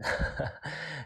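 brief high laugh; short chuckle. Real, not acted. Teacher amused by one times one example, short chuckle. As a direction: chuckle at your own example.

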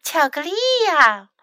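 high-pitched delighted exclamation; excited cry. A narrator's reading. Pretty fox sees chocolate, high excited cry. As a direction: exclaim over a treat. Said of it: "漂亮狐狸叫了起来".

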